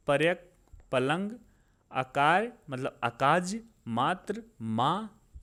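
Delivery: word-by-word list with pauses and lifted endings; slow dictation of vocabulary pairs; slow, deliberate recitation. Shown as a demonstration. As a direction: read each word pair out unhurriedly for learners to repeat. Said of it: "मैं बहुत आराम आराम से बोल रहा हूँ".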